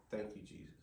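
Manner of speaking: very soft, whispery prayer of thanks; retold quiet relief; hushed thanks. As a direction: breathe out thanks to God. Retelling his relieved prayer.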